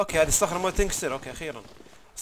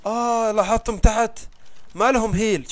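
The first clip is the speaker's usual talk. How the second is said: surprised; 6 semitones higher, 6 dB louder, slower than usual. Real, not acted. Points out tools have no durability.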